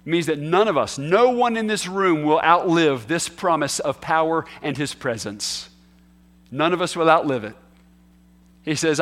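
ordinary talk, nothing marked

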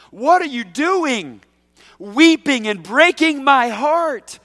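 high, loud, slow anguished plea; heartbroken Paul. Retold or imitated. Pastor voicing Paul rebuking weeping friends, anguished and loud. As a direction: cry it out as a hurt friend. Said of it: "I can hear Paul".

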